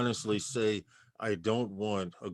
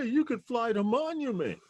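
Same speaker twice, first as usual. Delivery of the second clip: high-pitched mocking quote; much higher put-on voice; mock enthusiast; 12 semitones higher than usual. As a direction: imitate a clueless enthusiast way up high. Voices the would-be drone representatives.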